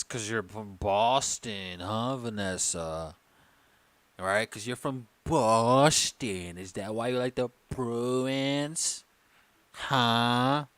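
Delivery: put-on slow, drawn-out teasing Boston accent; put-on Boston drawl, needling a fan; mock Boston accent. Teasing Vanessa about being from Boston.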